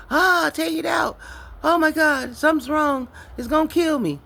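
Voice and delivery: high, loud panicked cries of pain; reliving the agony of childbirth, shrieking; screaming in labor pain. Retold or imitated.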